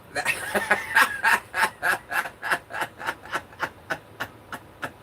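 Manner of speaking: breathy helpless laughing fit; real laughter. Real, not acted. Mother telling viewers about her ex, real laughing fit. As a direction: laugh out loud at the absurd memory.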